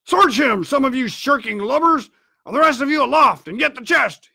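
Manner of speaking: high, impatient barked orders; swearing angrily. A narrator's reading. Blind man swears at his crew for delay, angry shout. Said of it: "swore at them again".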